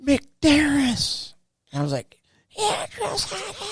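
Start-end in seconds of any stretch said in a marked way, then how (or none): 0.0-1.3 high, drawn mock yell; sergeant yelling name. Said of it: "He just yells people's names". 2.5-3.6 very high, slow, thin answer, softer than usual; squeaky recruit reply. Retold or imitated. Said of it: "did I not yell loud enough".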